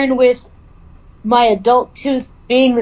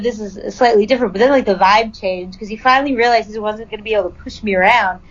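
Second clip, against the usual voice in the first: usual speaking voice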